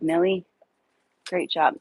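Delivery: ordinary talk, nothing marked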